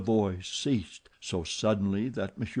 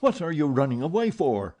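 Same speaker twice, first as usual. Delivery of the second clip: higher, louder furious call; 6 semitones higher, 4 dB louder than usual. Acted. Phantom furiously calling after fleeing Christine. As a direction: chase her with the question, furious. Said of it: "asked the furious voice".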